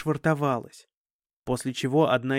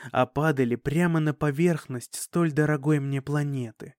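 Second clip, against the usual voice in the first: usual speaking voice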